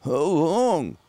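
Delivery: a touch of panicked begging through a tube; desperate patient. Retold or imitated. Patient asking the doctor how long, mock desperate plea. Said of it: "and I'm like".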